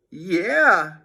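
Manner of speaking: backing up his friend; Harold, boy voice. Acted. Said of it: "said Harold".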